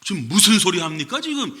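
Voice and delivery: loud, high rebuke; rebuking. Retold or imitated. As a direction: snap back at him in disbelief. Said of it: "이런 느낌이란 말이에요".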